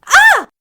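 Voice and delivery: sharp high shriek; scream. Acted. Poppy slamming the shed door, shrieking in fright.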